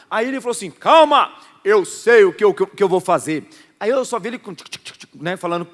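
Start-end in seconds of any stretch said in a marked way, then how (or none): 0.7-3.5 calm him down confidently; confident friend. Retold or imitated. Friend telling pastor he has a plan, loud.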